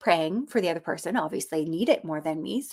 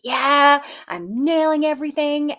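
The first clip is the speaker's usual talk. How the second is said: bright, higher cheer of self-satisfaction; excited; 7 semitones higher, 6 dB louder than usual. Retold or imitated. To herself while solving problems, excited cheer. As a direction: crow with glee at your own success. Retelling feeling great, quoting self.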